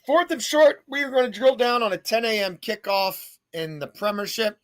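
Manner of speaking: loud, high announcer hype; hyped announcer. Acted. Host pumping up the listeners, loud announcer hype. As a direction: kick off the segment like a game announcer. Sports betting pick announcer intro.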